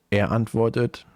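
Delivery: usual speaking voice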